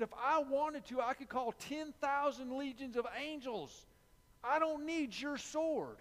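high, loud, commanding Jesus, slowing on the last words; Jesus speaking. Retold or imitated. Jesus telling Peter he needs no sword, loud and high. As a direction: declare it with full authority, building.

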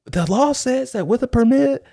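high, fast heated protest; fifteen-year-old arguing the permit law with the cop, heated and high. Retold or imitated. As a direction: argue the law, getting worked up. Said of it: "I start getting heated".

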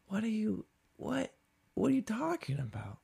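high, soft, stammering incredulity; baffled disbelief. Retold or imitated. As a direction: stammer it high and quiet, dumbfounded. Retells own baffled reply to barista.

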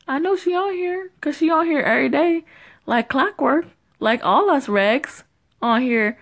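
fast, high, excited rant; excited high chatter. Real, not acted. Caller to host, hyped and high-pitched.

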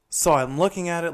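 ordinary talk, nothing marked

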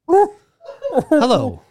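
throaty voice. Acted. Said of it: "the fucking throat thing".

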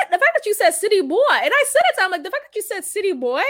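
high, rapid disbelief; incredulous. Retold or imitated. Woman calling out her caller's claim, incredulous. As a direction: act out disbelief, rushing the words.

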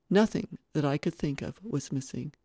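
ordinary delivery

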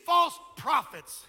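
loud, driving pulpit delivery; full-force preaching. Real, not acted. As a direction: hammer key words and stretch them out.

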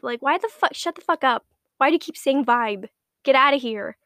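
loud, fed-up rant; angry rant. Real, not acted. Vlogger raging at men saying vibe, loud angry rant. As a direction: yell it off, disgusted and fed up. Ranting about the word vibe.

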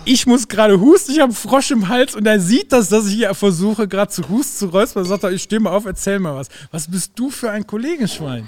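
loud, high, hammed-up indignation; mock-indignant rant at the co-host; mock outrage. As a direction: complain loudly, half joking.

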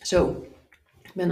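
words around a sip of water; while sipping water. Real, not acted. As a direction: talk right before and after a sip. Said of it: "een slokje water". (tussen slokjes water door)

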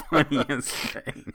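normal talking voice

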